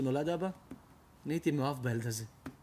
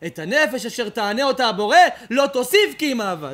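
intoned scripture; 8 semitones higher, 13 dB louder than usual. Acted. Quoting scripture in full voice.

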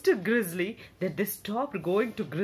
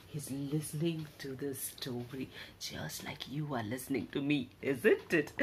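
much softer, lower murmur; tender aside; 6 semitones lower, 7 dB quieter than usual. Acted. Storyteller gently addressing the listening child, much softer.

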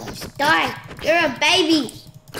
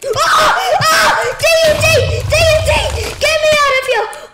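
frantic high shouts about the TNT; panicked screaming; 11 semitones higher, 7 dB louder than usual. Real, not acted. Gamer screaming at the exploding TNT in panic. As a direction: scream for your life as it blows.

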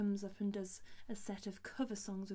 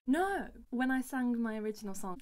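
indignant; 3 semitones higher, 7 dB louder than usual. Real, not acted. Singer rejecting the sob story she was expected to give, indignant. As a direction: snap out of the mock story, forceful.

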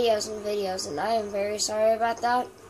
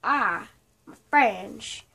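mock posh apology; 4 dB louder, much slower than usual. Mock apology after swearing.

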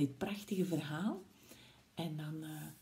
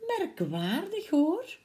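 higher, wondering Gruffalo with a rising tune; puzzled Gruffalo, higher; 8 semitones higher, slower than usual. Acted. Gruffalo puzzled again as Owl flees, higher and rising. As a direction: ask it half aloud, baffled. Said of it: "De Gruffalo zei".

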